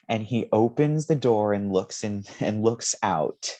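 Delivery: ordinary talk, nothing marked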